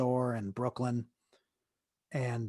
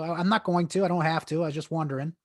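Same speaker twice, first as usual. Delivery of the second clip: raised pitch and volume, easing down; defensive backpedalling; 5 semitones higher, 5 dB louder than usual. Retold or imitated. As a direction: act out protesting your innocence. Acting out his defensive reply.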